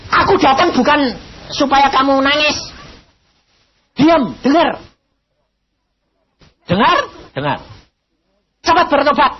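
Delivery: loud, high stern rebuke; scolding. Retold or imitated. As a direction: tell him off, stop his crying. Scolding the weeping sick man. (memarahi dengan keras dan tinggi)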